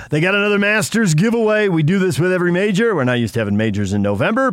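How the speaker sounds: upbeat announcer read; radio contest promo. Acted. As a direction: sell the giveaway like a DJ. Radio host reads contest promo.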